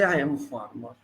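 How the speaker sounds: soft, low, reluctant admission; hesitant farmer imitation. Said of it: "He hesitates to say".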